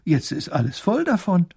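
little grand duke. Acted. Grand duke telling his child he was always alone, animated. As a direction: play a lively, self-absorbed little ruler. Großherzog speaks in a story.

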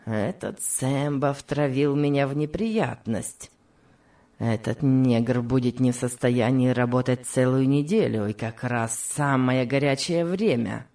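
low, grumbling mutter; surly master's low mutter; muttering villain. A narrator's reading. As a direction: grumble to yourself through clenched teeth. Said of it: "бормотал сквозь зубы".

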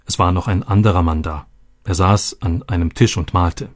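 plain reading voice

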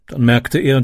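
ordinary delivery, nothing marked

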